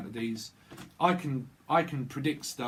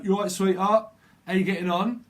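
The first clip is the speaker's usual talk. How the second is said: louder, higher London chat-up voice; lightly acted advert man, pitched up; advert coach imitation; 6 semitones higher, 7 dB louder than usual. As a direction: call out a breezy London greeting. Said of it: "he used to go".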